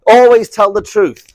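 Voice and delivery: high, very loud, slow proclamation; mock dogmatic slogan. Absolutist reciting the truth rule, loud mock proclamation. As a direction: declaim it like an unbending rule.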